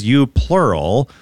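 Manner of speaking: "You plural" slow, "You" rising; slow, pointed "You plural"; stress on plural. Shown as a demonstration. Host correcting the individual reading, slow pointed "You plural". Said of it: "But Jesus says".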